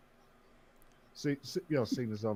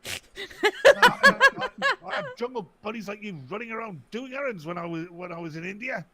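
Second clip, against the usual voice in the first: put-on high, laughing cranky old man blustering; put-on old colonial codger; 9 semitones higher, 9 dB louder than usual. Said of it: "put the voice on".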